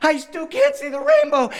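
put-on frustrated small child near tears; hammed-up tantrum wail; wailing toddler. Daughter's frustration mounts to tears.

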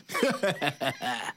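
low scornful chuckle; mocking laugh. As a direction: laugh down at her. Giant laughs.

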